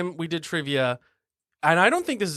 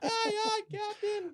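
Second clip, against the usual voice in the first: put-on high squeaky kids' shout; SpongeBob kids reply; 10 dB quieter, slower than usual. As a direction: answer like cartoon kids. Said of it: "the pirate at the beginning of SpongeBob".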